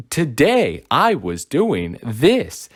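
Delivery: exaggerated singsong swooping up and down on each phrase; slow, wavy mock text-to-speech. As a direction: mimic a text-to-speech voice, slow with big pitch swings. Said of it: "the tones and the pitch".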